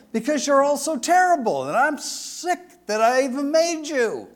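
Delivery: high, fast, fed-up divine declaration; hammed-up voice of God fed up with mankind; exasperated God. God condemning humanity before the flood, high and exasperated.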